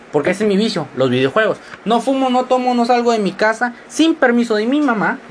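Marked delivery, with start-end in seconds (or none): none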